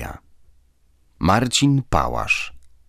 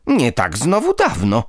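bristling, indignant; 6 semitones higher than usual. A narrator's reading. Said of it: "zaperzyła się Dusia".

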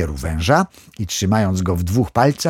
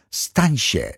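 plain reading voice

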